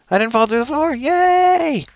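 squeaky-high playful cheer. Pretend. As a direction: celebrate in a silly high voice, stretching the yay. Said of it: "Not in that high of a voice".

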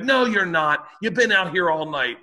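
high, loud accusing woman; accusing neighbor. Retold or imitated. Neighbor accusing him of noise all night, high mimicry. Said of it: "She was like".

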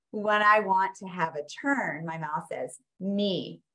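playful, sing-song read-aloud for toddlers; reading to children. Acted. Parent reading the board book to a toddler, playful sing-song. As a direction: read it like a picture book for a baby.

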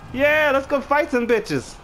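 high, slow hyped-up holler; rallying war whoop. Pretend. Gamer heading off to fight, rallying holler. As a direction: draw it out high like a battle rally.

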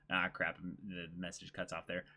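usual speaking voice

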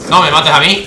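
loud, urgent cry to an attacker; alarmed protest. Real, not acted. Player to an enemy about to kill him, alarmed protest. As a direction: call out in sudden alarm. Nearly killed in the game.

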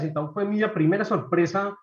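ordinary talk, nothing marked

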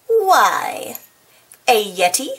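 ordinary delivery, nothing marked

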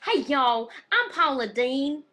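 put-on loud, drawling Southern US accent; Paula Deen. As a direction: play a Southern TV cook, big and loud. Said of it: "Do we do accents?".

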